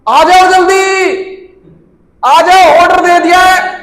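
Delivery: high, loud call from over the wall. Retold or imitated. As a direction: shout it across to friends waiting behind. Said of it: "बाहर से आवाज मार रहा है". (दीवार के पार से दोस्तों को ज़ोर से पुकारना)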